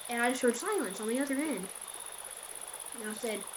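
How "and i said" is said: ordinary delivery, nothing marked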